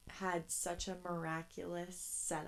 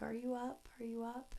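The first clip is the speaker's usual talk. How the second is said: lightly acted, higher-pitched check-in; a hint of an eager, higher-voiced kid calling; imitated walkie-talkie call; 5 semitones higher, 3 dB quieter than usual. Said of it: "we would say on the walkie-talkie".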